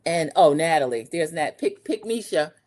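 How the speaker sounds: ordinary talk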